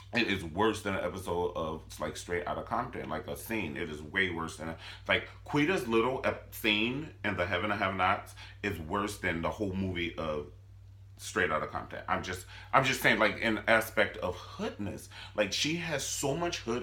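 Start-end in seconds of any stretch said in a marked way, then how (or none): none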